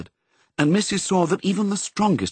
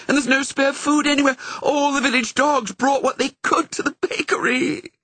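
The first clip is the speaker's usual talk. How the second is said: a much higher wailing cry; wailed. Acted. The setter despairing over the failed plans, wailing. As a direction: let it spill out in a helpless wail.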